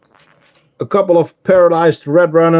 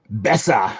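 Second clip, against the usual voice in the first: mock German accent; 5 semitones lower, 5 dB quieter than usual. Shown as a demonstration. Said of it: "Try German, a German accent".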